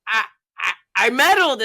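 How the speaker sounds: excited stutter. Retold or imitated. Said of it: "stuttering all over the place".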